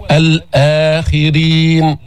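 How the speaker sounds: slow chanted الآخرين with the opening aa held; measured recitation, long vowels in الآخرين; two-count lengthening. Shown as a demonstration. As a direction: hold the آ of الآخرين for two beats. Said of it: "يمد بمقدار حركتين".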